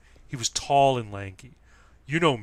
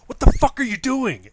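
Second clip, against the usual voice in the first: furious retold yell; angry shout; 13 semitones higher, 12 dB louder than usual. Guy who tripped him, confronted to his face, shouted furious. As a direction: yell it high and loud, right in his face.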